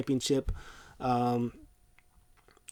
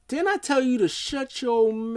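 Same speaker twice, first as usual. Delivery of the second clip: much higher, louder; mock angry yell; 11 semitones higher, 4 dB louder than usual. Brock about to punch Heyman, hammed-up angry yell. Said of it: "And he goes".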